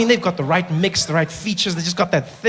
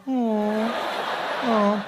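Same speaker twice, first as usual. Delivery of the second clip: soft, droopy sigh; softer mock-sad little moan; sad butt; 3 semitones higher, 7 dB quieter than usual. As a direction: deflate like a sad balloon. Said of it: "Just like".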